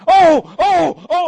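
loud pained grunts of aching knees; groaning while running. Retold or imitated. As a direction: groan with every stride. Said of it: "it sounds something like this".

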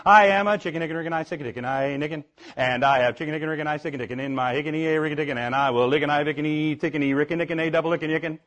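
rattled off fast, nearly laughing. Shown as a demonstration. Preacher speeds through the chicken song to show off. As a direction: race through the tongue-twister. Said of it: "I can go faster".

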